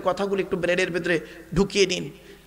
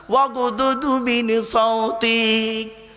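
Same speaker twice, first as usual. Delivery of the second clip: very slow melodic chant, a bit louder; 4 dB louder, much slower than usual. Shown as a demonstration. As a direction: stretch every syllable like a tilawat. Recites verse of the Quran. (ধীর সুরেলা তেলাওয়াত)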